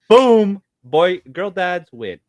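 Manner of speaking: loud, triumphant, then slow and emphatic; victory announcement, loud then drawn out; triumphant boom. Real, not acted. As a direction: slam it down like a winning point. Declaring victory in debate.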